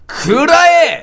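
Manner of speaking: loud battle cry; shouted attack cry. Shown as a demonstration. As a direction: shout it like a finishing move. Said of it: "だから、".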